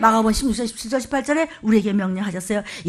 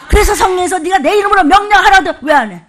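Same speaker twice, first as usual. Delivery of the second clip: higher, much louder preaching cry; loud exhortation; 6 semitones higher, 9 dB louder than usual. Real, not acted. Pastor challenges the congregation to obey, shouting with full force.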